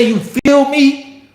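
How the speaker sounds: high, stretched "feel me"; slow, high-pitched hype check; somebody asking emphatically. Acted. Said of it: "You know when somebody say".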